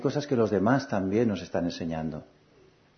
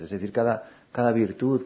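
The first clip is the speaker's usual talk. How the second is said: normal talking voice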